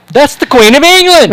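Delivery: high, loud shocked exclamation; stunned realization. Retold or imitated. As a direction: blurt it out in disbelief. Tourists realise who she was.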